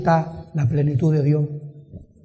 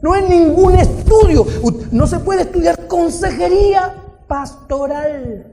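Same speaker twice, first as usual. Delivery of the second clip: raised, higher pulpit voice with punched key words; forceful preaching; 7 semitones higher, 4 dB louder than usual. Real, not acted. Preacher teaching the congregation about counsel, forceful.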